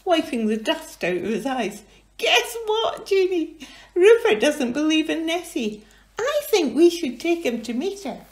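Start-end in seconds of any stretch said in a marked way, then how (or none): 2.2-8.2 put-on high, loud eager child voice; high excited little voice; Angus voice. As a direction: eagerly share the plan.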